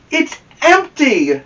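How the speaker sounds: disappointed discovery; surprised dismay. Acted. Alone at home, Amelia Bedelia finds the cookie jar empty, high and dismayed.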